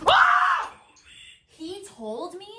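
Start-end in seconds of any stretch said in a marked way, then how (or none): 0.1-0.7 sudden high, loud scream; real yelp of fright; startled scream. Real, not acted. Startled by a voice during the ghost hunt, screaming. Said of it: "I screamed".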